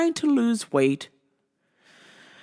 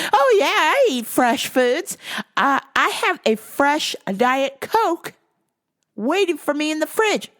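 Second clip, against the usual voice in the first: Diet Coke lady; 6 semitones higher, 4 dB louder than usual. Retold or imitated. Customer telling the clerk her diet habits, caricatured and emphatic. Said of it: "Here she is, Diet Coke lady".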